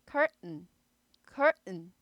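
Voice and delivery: curtain with a curled r then a stopped t and n; American curtain. Shown as a demonstration. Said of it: "구부리다가 은".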